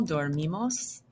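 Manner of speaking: ordinary talk, nothing marked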